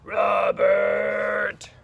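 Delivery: loud, drawn-out teacher's bellow; teacher's shout. Acted. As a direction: holler the name long and loud across a classroom. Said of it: "And she's going".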